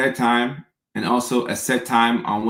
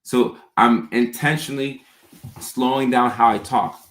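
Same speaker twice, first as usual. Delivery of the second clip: usual speaking voice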